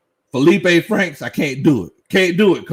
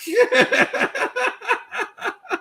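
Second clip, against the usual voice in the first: high burst of laughter; 3 dB quieter than usual. Real, not acted. Chat joke about him.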